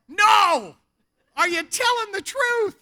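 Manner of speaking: high, loud Australian yell; acted outburst, voice way up; exasperated shout. Misunderstood man finally yells his meaning.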